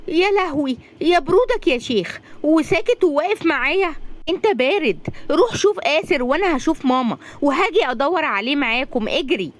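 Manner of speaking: high, urgent alarmed voice; startled and frantic, urging him to run; alarmed panic. Acted. Razan panics about the lost boy, high frantic alarm. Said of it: "رزان بخضة".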